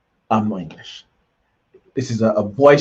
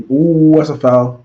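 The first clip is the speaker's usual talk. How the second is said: excited foul call; 5 semitones higher, 8 dB louder, slower than usual. Real, not acted. Reacting live to a foul.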